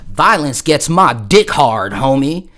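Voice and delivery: slow, loud Southern twang drawl. Retold or imitated. Turtle to the dude, slow Southern twang. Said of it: "you gotta have that Southern twang".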